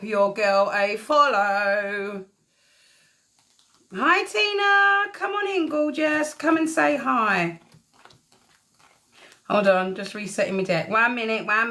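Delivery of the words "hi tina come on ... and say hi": sing-song greeting. Real, not acted. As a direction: greet her like a long-lost friend, way up high. Greeting a viewer joining live.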